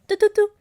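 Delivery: high, loud sung "doo, doo, doo" chime; imitated notification ping. Host imitating her Slack notifications going off, high ping. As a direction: mimic the Slack alert tone. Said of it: "It keeps going".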